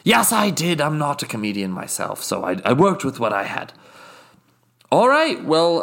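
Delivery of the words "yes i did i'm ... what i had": haughty British guest, smug and unhurried; a snooty Brit savouring every indiscretion; posh British accent. Acted. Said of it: "my British accent slips".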